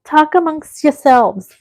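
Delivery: high, slow put-on New York accent. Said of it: "That's my New York accent".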